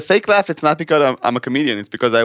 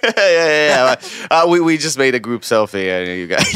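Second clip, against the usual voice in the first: ordinary talk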